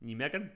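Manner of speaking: "Nijmegen" as NEE-meg-en, rising; soft questioning "Nijmegen", no zh sound; trying a pronunciation. Shown as a demonstration. Streamer unsure of a town name, questioning "Nijmegen" as NEE-meg-en. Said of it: "why am I saying it".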